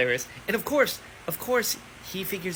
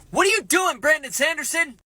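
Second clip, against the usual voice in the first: much higher and louder, calling out the author; exasperated outcry; 9 semitones higher, 5 dB louder than usual. Real, not acted. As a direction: throw the question at the author in disbelief. Exasperated at author over twist.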